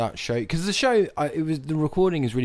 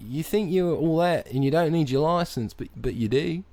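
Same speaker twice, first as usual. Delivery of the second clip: hammed-up Aussie know-it-all; Australian accent. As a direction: lecture in a put-on Australian accent. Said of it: "you've sustained this accent".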